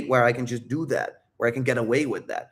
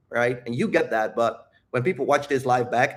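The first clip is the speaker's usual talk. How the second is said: ordinary delivery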